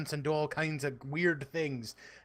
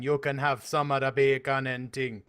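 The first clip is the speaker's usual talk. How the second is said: a hint of a Jamaican accent; 2 semitones lower than usual. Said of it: "accent that goes back and forth to Jamaica".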